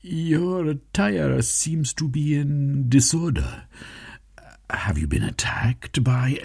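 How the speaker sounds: high, slow, worried servant voice; Gentleman Smythe, concerned. Acted. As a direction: fret over his master's state.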